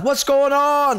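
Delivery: high called-out question; yelling out. Retold or imitated. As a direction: call out to the passing crowd. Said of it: "he yells out".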